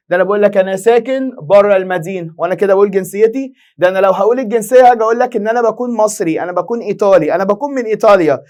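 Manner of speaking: normal talking voice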